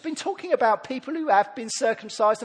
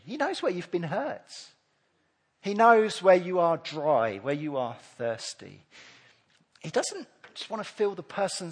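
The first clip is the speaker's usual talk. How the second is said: tender and intimate; 7 dB quieter than usual. Acted. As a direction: speak close, like a secret meant for one person. Tender intimate preaching passage.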